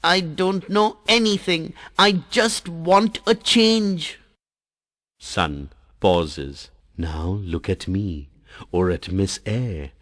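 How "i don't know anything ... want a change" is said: put-on stamp it out like a stubborn child; in a huff. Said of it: "Jal turns away in a huff".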